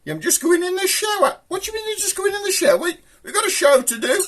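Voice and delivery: puppet-voice outrage; high squeaky voice. Acted. Relaying Sweep's squeaks, indignant.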